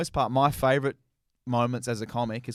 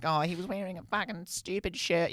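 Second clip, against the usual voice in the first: higher-pitched mock girlfriend voice; complaining girlfriend; 6 semitones higher, 4 dB quieter than usual. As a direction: put on an exasperated girlfriend, pitched up. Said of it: "then she'll be like".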